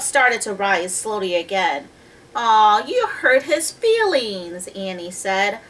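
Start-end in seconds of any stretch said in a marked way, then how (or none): 2.4-4.7 high, drawn-out sympathetic scolding; reproachful pity. A narrator's reading. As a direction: chide him loudly with a long "aw". Annie scolds Jack, sorry for octopus.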